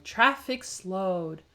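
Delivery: ordinary delivery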